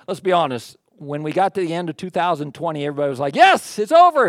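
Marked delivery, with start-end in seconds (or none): 3.3-4.3 loud, high mock cheer; excited relief. Pastor voicing everybody at the end of twenty twenty, excited relief.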